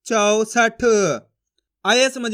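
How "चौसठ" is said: चौसठ in slow, level, evenly drawn syllables; blended syllables. Shown as a demonstration. Teacher blending the letters for learners, slow and deliberate. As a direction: join the sounds slowly, full au in चौ. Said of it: "तो मिलके बन जाएगा".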